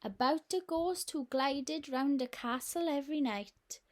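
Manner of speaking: ordinary delivery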